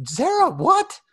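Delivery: high, loud disbelieving yelp; playfully scandalized outburst; mock shock. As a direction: squawk it in comic disbelief. Mock shock at another raised hand.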